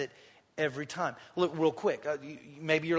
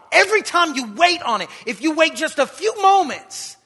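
higher, louder preaching, building; rising preacher's cry; 8 semitones higher, 13 dB louder than usual. Acted. Preacher confronting the congregation about missed miracles, loud. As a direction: lift it up and press the point.

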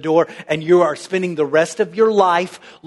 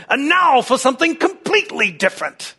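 put-on high British announcer accent, in one run; hammed-up British accent, pitched high; John Cleese; 6 semitones higher than usual. Preacher mimics comedy skit hand-over, mock British accent. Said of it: "he'd say it in British accent".

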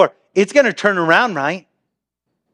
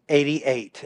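ominous hush; 6 semitones lower, 8 dB quieter than usual. Acted. Grim reveal of Psalm 88.

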